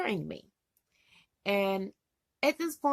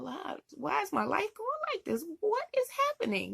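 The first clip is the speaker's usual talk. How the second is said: tearful plea to God; 12 semitones higher than usual. Retold or imitated. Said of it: "just in tears going".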